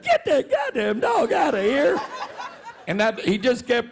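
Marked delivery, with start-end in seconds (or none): 0.0-2.0 high yelled cry across the arena; retold scream. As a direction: yell it after the dog. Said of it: "screamed out".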